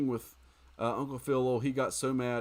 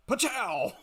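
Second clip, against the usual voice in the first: mock impact noise; 11 semitones higher than usual. Imitating a game attack hit.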